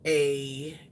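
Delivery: usual speaking voice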